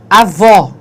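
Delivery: avó loud, with an open final O as in "aw". Shown as a demonstration. Teacher reading out the example word avó, open stressed O. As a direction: hit avó hard on a wide-open O. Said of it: "o som é aberto".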